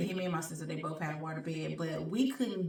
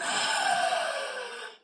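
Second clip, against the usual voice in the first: huge, high gasp for air; re-enacted gasp. Showing first breath after the fall, exaggerated gasp. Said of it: "I was like".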